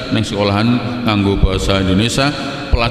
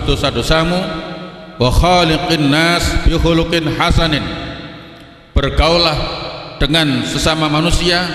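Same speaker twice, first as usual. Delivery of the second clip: khutbah tone; 6 semitones higher than usual. Shown as a demonstration. Preacher demonstrating to the congregation how a Friday khutbah sounds, raised and chanted. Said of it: "kira-kira khutbah itu nadanya begitu".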